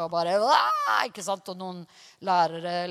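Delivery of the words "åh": imitated yell. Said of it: "bare ropte og hylte".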